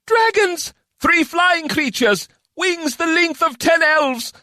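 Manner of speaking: very high, slow alarmed cries; war mages shouting over each other. A narrator's reading. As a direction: call the warning in panic. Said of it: "voices on top of each other".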